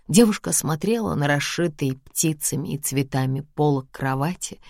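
ordinary delivery

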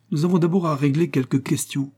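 stern father's rough demand; gruff father. A narrator's reading. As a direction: rebuke your son coldly. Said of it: "fit-il rudement".

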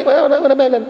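high dismissive quip; mock indifferent reply. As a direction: brush it off. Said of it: "حاجه ما تعني".

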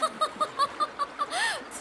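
high scornful laugh; mocking laugh. The river laughing at the little pea's plan, high and mocking. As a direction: laugh at the tiny hero. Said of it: "فریاد زد".

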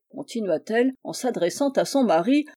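ordinary delivery

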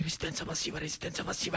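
hushed, breathy repeated vow; whispered defiance. Acted. Slapped boy vowing passive resistance, hushed whisper. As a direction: whisper it to yourself, stubborn.

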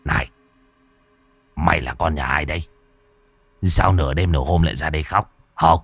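low, gruff questioning; gruff old master. A narrator's reading. Môn Phọt gruffly questioning the crying girl, low voice. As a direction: interrogate her low and gruff. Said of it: "Thầy Môn Phọt mới hỏi".

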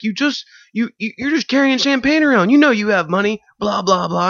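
very high, indignant squeal; shrill accusing drunk girl. Retold or imitated. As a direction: drunk girl demanding money for dry cleaning. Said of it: "she was like".

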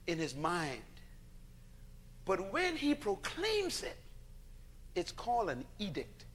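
ordinary delivery

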